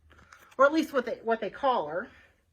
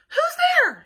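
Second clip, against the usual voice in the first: much higher and louder, woken and scared; startled call; 11 dB louder than usual. Acted. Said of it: "she said".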